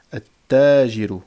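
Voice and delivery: loud "at-tajiru", no L, held double t; doubled first letter. Shown as a demonstration. Teacher demonstrating the shadda to learners, doubled t. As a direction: lean hard on the doubled t of "at-tajiru". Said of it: "on va doubler, on va mettre une shadda".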